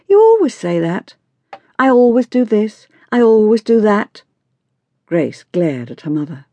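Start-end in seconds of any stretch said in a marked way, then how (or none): 0.1-4.2 high, mocking parroting of a parent; mocking teenager. A narrator's reading. Daughter parrots her mother's habitual phrases, mocking and irritated. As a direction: throw the stock phrases back in a higher voice.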